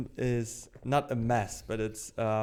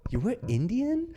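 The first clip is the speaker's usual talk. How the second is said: mock incredulous; 10 semitones higher than usual. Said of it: "And she's like".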